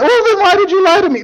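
very high, hammed-up complaint; mock wounded reproach. Delilah reproaches Samson, mock wounded reproach. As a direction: demand an answer in a high put-on voice.

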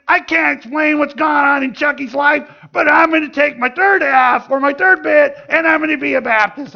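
high, loud imitation of a grandma; little old lady. Grandma with oxygen tank announces her conversion, high old-lady voice. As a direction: play a feisty old woman declaring herself, pitched well up. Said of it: "this little old lady".

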